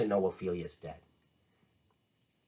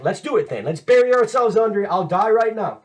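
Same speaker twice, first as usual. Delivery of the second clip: reckless dare; 6 semitones higher, 5 dB louder than usual. Retold or imitated. Acts Hamlet daring Laertes.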